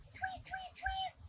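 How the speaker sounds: very high, tiny tweets; high soft birdsong tweets; bird tweet. Acted. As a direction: tweet it like a little songbird. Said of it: "Open your beak and sing".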